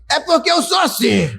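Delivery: high, shouted mock of a proud man; hammed-up defensive shout; stubborn excuse. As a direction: play the man who won't cry. Voicing a stubborn man's excuse.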